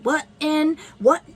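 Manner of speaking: mock someone's speech with rising whats; taunting imitation. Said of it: "he just kept taunting the way I was talking".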